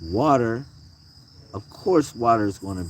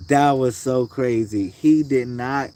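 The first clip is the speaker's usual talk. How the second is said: normal talking voice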